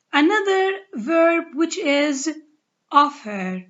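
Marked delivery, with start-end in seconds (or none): 2.9-3.6 "offer" stressed on its first syllable. Shown as a demonstration. Teacher contrasting another verb for learners, first-syllable stress on "offer". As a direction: hit the "of-" of "offer", let "-fer" drop. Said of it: "syllable, on the first syllable".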